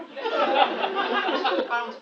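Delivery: words pushed through laughter; can't hold back the laugh; laughing while talking. Real, not acted. Laughing at own joke, toast.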